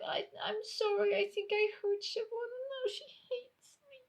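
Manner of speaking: very high, tearful squeak; childlike tearful confession, fading at the end; Trixie. Acted. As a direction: wail it in a tiny crying voice. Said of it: "Trixie turns around with her weird ass hair".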